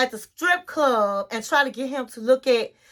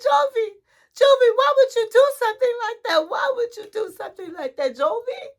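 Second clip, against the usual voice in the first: high, fast, wailing reproach; hammed-up high-pitched mock distress; upset wife; 10 semitones higher, faster than usual. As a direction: imitate a wife pleading with her husband in dismay. Retold wife confronting husband.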